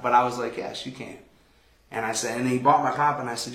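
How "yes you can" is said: soft, unvoiced whispered yes; soft acceptance. Speaker accepting a stranger's offer to buy his pop, whispered. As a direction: breathe the words out gently.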